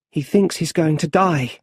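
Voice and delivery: ordinary delivery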